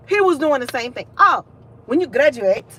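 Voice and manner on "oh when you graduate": high mock African professor accent; exaggerated African accent. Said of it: "what kind of accent that was".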